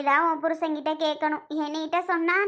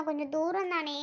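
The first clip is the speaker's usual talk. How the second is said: ordinary delivery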